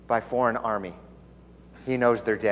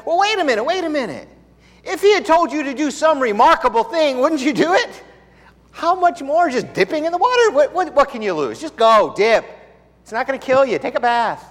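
high, loud coaxing servant; pleading servant; 12 semitones higher, 5 dB louder than usual. Retold or imitated. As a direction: coax the angry master to just try it. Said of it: "one of the servants said".

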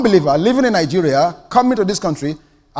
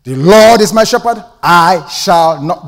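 loud "Lord", slow drawn-out "I shall not"; emphatic reading; 8 dB louder, slower than usual. Shown as a demonstration. Preacher makes the congregation hear the psalm, slow and pointed. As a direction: land hard on "I shall not". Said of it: "Did you hear that?".

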